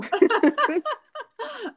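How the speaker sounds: high real laugh after the punchline. Real, not acted. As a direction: laugh at the shared moment. Rit après la citation. (rire franc et aigu)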